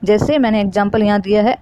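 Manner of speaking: normal talking voice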